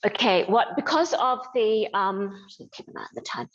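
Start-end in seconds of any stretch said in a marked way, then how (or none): 2.7-3.5 unvoiced quick aside; whispered aside. Real, not acted. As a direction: whisper it off to the side. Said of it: "aside to helper, unvoiced".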